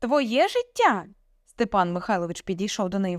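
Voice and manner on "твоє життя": high, slow indignant question; incredulous echo. A narrator's reading. Father mocking daughter's claim, incredulous. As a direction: drag out the question in disbelief. Father throws her words back.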